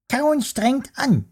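very high, slow squeaky worm insisting; protesting worm. Acted. Worm insists chewing is hard work, slow and squeaky. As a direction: object like a fussy tiny creature. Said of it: "widersprach der Wurm".

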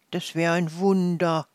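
ordinary delivery

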